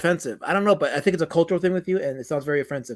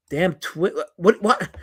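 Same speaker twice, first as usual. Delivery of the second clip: usual speaking voice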